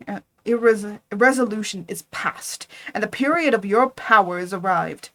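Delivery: loud, firm refusal; defiant anger. Acted. Frankenstein defies his creature, loud and angry. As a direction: throw the commands at him.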